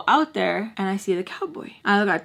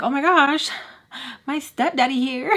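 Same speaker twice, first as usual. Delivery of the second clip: excited gasp; 5 semitones higher than usual. Retold or imitated. Hearing stepdad arrive, retold.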